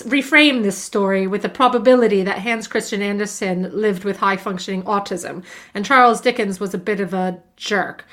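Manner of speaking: ordinary talk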